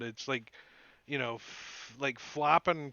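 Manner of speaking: ordinary talk, nothing marked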